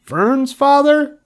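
very high, slow, disbelieving wail; acted sob. A narrator's reading. Wilbur asks about Fern's father, sobbing squeal. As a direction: wail it up high like a hurt piglet. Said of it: "sobbed Wilbur".

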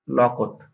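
লকট said for জামরুল; লকট, clear two syllables; Barisal dialect word. Shown as a demonstration. Teacher gives the Barisal word লকট. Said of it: "এটাকে বরিশালে বসা হয়".

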